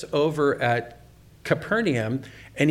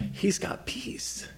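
whispered thought; 7 semitones higher, 7 dB quieter, much slower than usual. Retold or imitated. Inner thought in conversion story.

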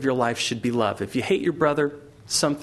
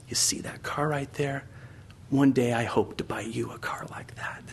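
put-on soft, whispered kid's voice; tender hushed promise; 5 dB quieter than usual. Kid to his crippled brother on the porch, tender whisper. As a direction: speak gently, almost whispering a hope. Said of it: "lays him down on the porch and says".

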